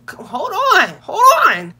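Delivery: high, loud mock alarm; alarmed protest. Narrator halts relative who stood up fast, alarmed. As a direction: yelp it twice, pitch jumping up. Acted alarm as she stands up.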